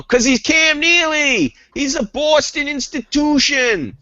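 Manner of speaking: slow, high put-on Brooklyn accent; a mock Brooklyn accent on a Boston fan; Brooklynese. Host slips into an accidental Brooklyn accent. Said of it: "which sounded Brooklynese when I said that".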